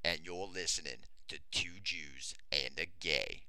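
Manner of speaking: Morgan Freeman impression. Podcast host introducing the show as Morgan Freeman, low mock narrator. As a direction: drop low and play the wise old narrator. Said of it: "I'm Morgan Freeman".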